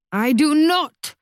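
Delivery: high, slow anguished cry; a mother's raw howl of grief. A narrator's reading. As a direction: wail it out in despair. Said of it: "Mamma howls".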